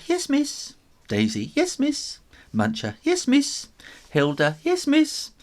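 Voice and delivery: very high, bright squeak; kitten voices. A narrator's reading. Kittens answering the teacher, very high. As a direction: answer the register like tiny kittens.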